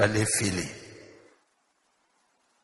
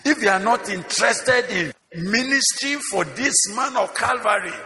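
high, ringing sermon delivery; impassioned preaching; 8 semitones higher than usual. Real, not acted. Pastor urging ministers to desire Christ's grace, impassioned and high. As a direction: preach it with rising fervour.

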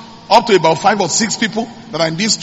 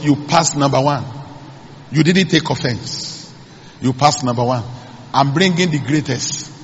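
a hint of God's voice; 5 semitones lower, 4 dB quieter than usual. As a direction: speak as God, calm and grave. God's voice, low and soft.

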